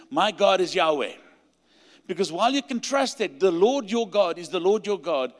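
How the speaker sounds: ordinary talk, nothing marked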